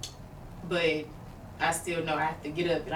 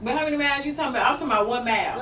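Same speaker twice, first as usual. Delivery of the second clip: higher, louder, fast teasing; playful challenge; 8 semitones higher, 6 dB louder, much faster than usual. Real, not acted. Joking with a friend about running distance, loud and fast. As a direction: tease her friend, quick and bright.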